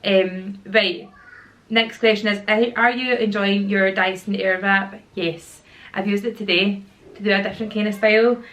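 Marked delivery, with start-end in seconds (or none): none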